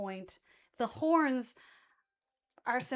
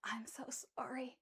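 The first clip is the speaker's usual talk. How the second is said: tearful whispered apology; unvoiced whispered sorry; 2 semitones lower, 16 dB quieter than usual. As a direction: breathe it out, barely voiced. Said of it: "she goes".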